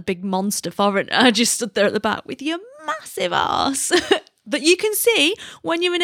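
laughing at herself as she talks. Real, not acted. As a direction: let giggles break into the self-mocking description. Laughing at herself in yoga class.

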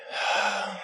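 long windy sigh from inside; gusty sigh. Acted. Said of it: "You hear a gusty sigh".

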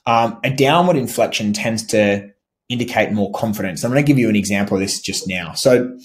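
ordinary talk, nothing marked

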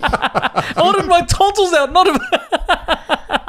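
high, drawn-out complaint breaking into laughter; mock angry. Patient protesting the wrong operation, mock angry through laughter. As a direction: play the outraged patient, cracking up. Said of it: "with a very angry accent".

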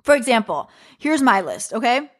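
ordinary delivery

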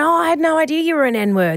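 high, casually cheerful mock remark; smiling, oblivious. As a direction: imitate someone saying it with a breezy smile. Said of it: "everyone does it with a smile".

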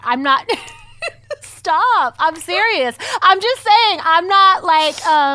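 loud, high flustered laughing protest; half laughing, begging them to stop. Real, not acted. Protesting amid laughter, louder.

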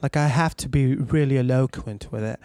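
put-on posh English accent; posh British accent. Shown as a demonstration. Voice actor showing hosts his British accent, posh and eloquent. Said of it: "British accents or anything like that".

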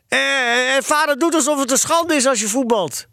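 put-on high-pitched indignant boy; worked-up boyish grievance; agitated protest. A narrator's reading. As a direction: stumble in with a heated complaint. Acted boy's heated complaint to mother.

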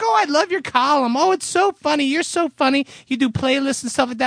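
high-pitched mock fangirl praise; fan gushing. Tech praising his column, gushing fan.

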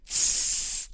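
long hissed searing sound; imitated sizzle. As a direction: mimic hot metal touching skin. Imitates hot metal touching skin.

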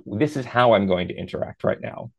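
ordinary delivery

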